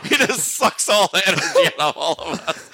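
words squeezed out through laughter; laughing. Real, not acted. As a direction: laugh while you say it. Laughing at energy vampire joke.

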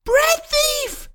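very high, piercing accusing cry; shrill shriek. A narrator's reading. Woman accuses the man of stealing bread, shrill shriek. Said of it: "the voice shrilled a second time".

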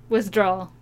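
ordinary talk

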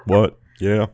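loud, high stage hype-man shouts. Retold or imitated. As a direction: pump up the crowd like a hype man. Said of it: "I'm like that guy on stage that just goes".

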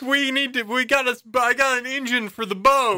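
high, excited friend voice; whiny friend. Pretend. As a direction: blurt it out, high. Acting out friend's complaint.

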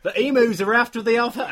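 loud, hammed-up Australian accent; a failed, over-the-top Australian accent; attempted Australian accent. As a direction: bellow it in a mock Australian accent. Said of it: "we should have done that in Australian accents".

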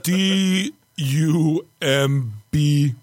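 one long-held letter at a time; spelled out slowly. Acted. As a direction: stretch each letter out, then land on the word. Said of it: "he's D space U space M space".

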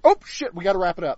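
high, loud sudden panic; mock filmmakers scrambling; panicked rush. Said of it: "and it's like".

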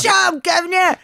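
high, loud mock Cockney cry; hammed-up British accent; bad British accent. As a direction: belt out a terrible Cockney. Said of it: "British accent".

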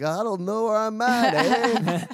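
hammed-up Canadian drawl with a big eh; Canadian accent. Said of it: "put the Canadian accent on really thick".

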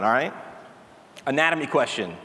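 usual speaking voice

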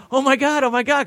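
giddy drugged patient; stoned delight. Retold or imitated. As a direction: gush it, floating on painkillers. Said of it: "'cause I'm stoned".

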